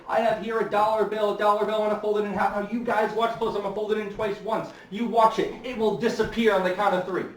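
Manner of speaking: hustle the crowd with showman's patter; barker patter. Retold or imitated. Barker working the crowd with a dollar bill, high quick patter. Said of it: "And they'd say".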